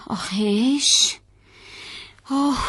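long, soft sigh of relief; drawn-out quiet "ahh" of relief; relieved sigh. Acted. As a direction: let the breath go slowly, relieved. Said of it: "پاش به زمین رسید گفت".

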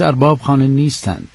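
usual speaking voice

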